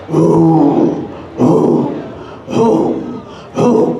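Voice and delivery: low drawn-out growling oohs; bear growl. Acted. Bear appearing in the woods, low growling oohs. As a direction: growl like a bear shaking the forest. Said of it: "and he was growling".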